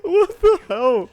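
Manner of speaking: high, loud, slow, crying with laughter; laughing disbelief. Real, not acted. Reacting to a wild episode, laughing hard. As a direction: gasp it out through laughter.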